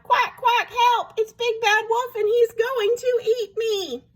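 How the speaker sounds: high, squealing duckling alarm; frightened cry. A narrator's reading. Duckling thinks he'll be eaten, crying for help. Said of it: "cried number five".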